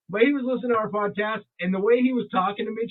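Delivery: normal talking voice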